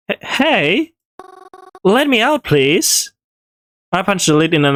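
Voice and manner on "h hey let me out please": high, rising alarm, stammering; scared calling out. Acted. Locked in the coffin, calling to Reginald, rising panic. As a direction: start to panic, call for help.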